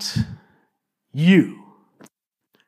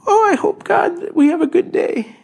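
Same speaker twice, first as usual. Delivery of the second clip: much higher, laughing little prayer; mock sweet prayer. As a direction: parody a tiny pocket-sized faith. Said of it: "and say".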